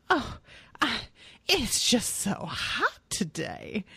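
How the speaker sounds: slow, fanning-herself Southern woman drawl; Elliott Kalan Southern. Retold or imitated. Podcaster imitates a comedian's old Southern woman, slow drawl. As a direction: sigh it out like a wilting Southern lady. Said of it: "like this old Southern woman".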